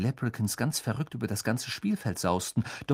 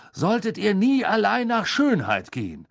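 high raised voice over a roaring crowd; calling over noise; 9 semitones higher, 4 dB louder than usual. A narrator's reading. Weasley warns the boys through the crowd noise, calling out. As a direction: call the warning out so it carries. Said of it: "rief Mister Weasley durch den aufbrausenden Lärm".

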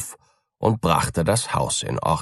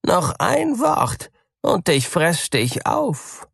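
high, slow threat; threatening cat; 11 semitones higher than usual. A narrator's reading. Cat threatening to eat the mouse, slow and high. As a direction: warn the mouse before pouncing. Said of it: "rief die Katze".

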